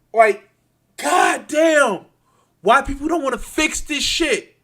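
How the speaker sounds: high, loud cry of frustration; exasperated anger. Real, not acted. Ranter asking why nobody fixes this, exasperated. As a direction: burst out in real exasperation. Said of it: "I'm so frustrated".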